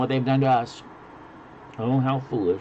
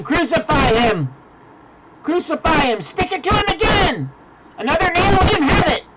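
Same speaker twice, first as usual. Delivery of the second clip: mob shouting; 4 dB louder than usual. Acted. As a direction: scream it like a bloodthirsty mob. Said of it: "you would have been shouting".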